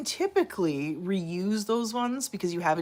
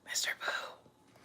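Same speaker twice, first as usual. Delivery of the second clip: whisper; 13 dB quieter, slower than usual. Real, not acted. Whispering to the cat.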